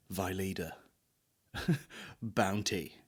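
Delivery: soft low tagline; mock slogan. Fake advert tagline, murmured low.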